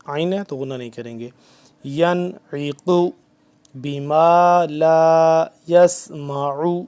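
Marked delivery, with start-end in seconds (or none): 1.8-6.8 slow, loud, chanted Arabic; tajweed recitation. Acted. Teacher resuming the verse for students, loud slow chant. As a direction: recite the verse in full voice, drawn out.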